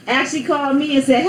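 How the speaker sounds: ordinary delivery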